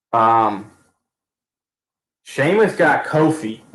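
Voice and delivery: ordinary talk, nothing marked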